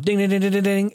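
sung slot machine ding-ding noises; slot machine jingle. Retold or imitated. Vlogger mimicking a slot machine, singsong dings. Imitating jackpot bells.

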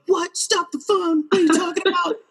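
high laughing disbelief; stunned friend. Retold or imitated. Friend hearing about the famous author, laughing high disbelief. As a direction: gasp it out in giddy shock.

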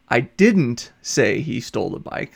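DIDN'T stretched out and leaned on, slow overall; stress on DIDN'T. Shown as a demonstration. Lecturer showing students how accenting works, firm stress on "didn't". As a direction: punch the word "didn't" and draw it out. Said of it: "pretty clear that you didn't say".

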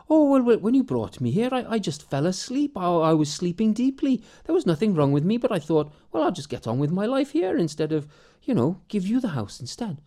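put-on high, fluttery elderly mother; old mother. Mother explains to her son why she stayed, high and chatty. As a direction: chatter on like a dotty old mum.

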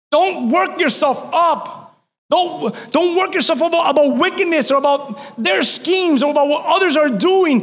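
shouted preaching. Acted. Pastor shouting the verse to the church, full-force preaching. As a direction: shout it to the back row.

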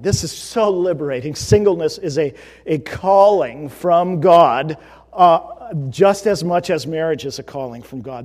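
very loud, emphatic preaching, stretching the key phrase; forceful preaching. Real, not acted. Pastor proclaiming singleness a calling from God, forceful preaching. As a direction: proclaim it at full volume.

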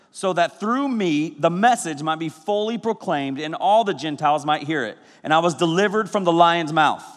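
solemn scripture reading. Acted. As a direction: read the verse with weight. Reads scripture aloud.

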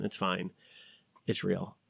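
normal talking voice

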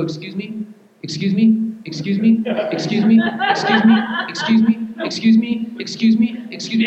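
put-on repeated, rising pleas of an eager child; impatient little boy. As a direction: nag like a four-year-old tugging at a sleeve. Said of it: "he would go".

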